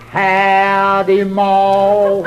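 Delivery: loud, high, long-drawn hillbilly greeting; slow backwoods call, held on one high note; mountain boy's holler. Acted. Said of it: "this is how he talks".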